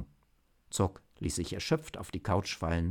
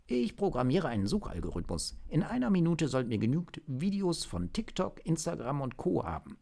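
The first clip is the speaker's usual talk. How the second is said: higher-pitched, keen agent; agent with sudden idea; 8 semitones higher than usual. Acted. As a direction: lay out a plan that just struck you. (Agent mit plötzlichem Einfall)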